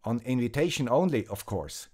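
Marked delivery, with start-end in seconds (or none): none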